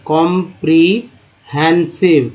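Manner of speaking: कॉम्प्रिहेंसिव said slowly, syllable by syllable; careful slow model of कॉम्प्रिहेंसिव; slow sounding out. Shown as a demonstration. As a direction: stretch out कॉम्प्रिहेंसिव for learners. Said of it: "teacher sounding out the word".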